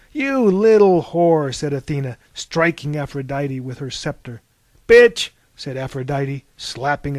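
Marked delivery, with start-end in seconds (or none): 0.1-1.5 loud, slow, raised-pitch insult; seething, stretched-out name-calling; furious insult. A narrator's reading. Insult while striking Aphrodite. 4.9-5.3 very loud, high, one-word burst; explosive shouted insult; angry insult. A narrator's reading. Insult with a slap.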